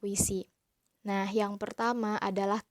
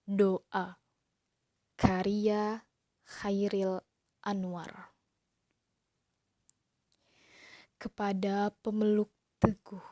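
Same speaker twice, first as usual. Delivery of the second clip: expressive poem recital; slower than usual. Shown as a demonstration. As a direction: recite the prayer poem with feeling, pausing between lines. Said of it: "[name] akan mencontohkan bagaimana cara membaca puisi".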